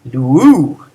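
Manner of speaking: "Luu" with a loud, swelling final U; stress on ending. Shown as a demonstration. Teacher showing students Greek imperatives, stressed ending. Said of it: "emphasize that weird ending".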